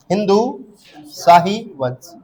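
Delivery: हिंदू शाही वंश spelled out slowly, word by word; slow dictation. Shown as a demonstration. Teacher dictating the dynasty name to students, slow and deliberate. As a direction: dictate it so they can write. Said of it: "ऐसा लिखो".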